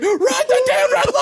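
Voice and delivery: high, loud retold scream. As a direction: yell the order at the driver. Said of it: "I'm screaming".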